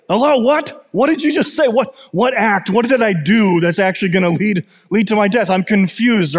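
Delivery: high-pitched, confused outcry; mock screaming. [name] as Harold yelling at an unseen narrator, mock screaming. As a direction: act out calling to a random voice in confusion. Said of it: "he's screaming this out to this random voice".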